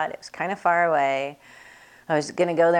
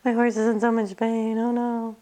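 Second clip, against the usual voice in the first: mock wail; 6 semitones higher than usual. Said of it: "they'd kinda cry".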